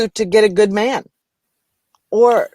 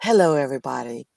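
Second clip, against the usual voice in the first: radio voice; 4 semitones lower than usual. Shown as a demonstration. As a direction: sell the dream like a late-night radio host. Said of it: "here comes the voice".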